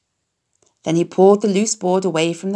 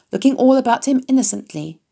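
plain reading voice

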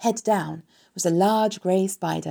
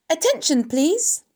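high, raised announcing call; loud, firm; 10 semitones higher, 4 dB louder, slower than usual. A narrator's reading. Pig addressing the whole barn, loud and firm. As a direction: call for attention loudly and firmly. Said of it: "in a loud, firm voice".

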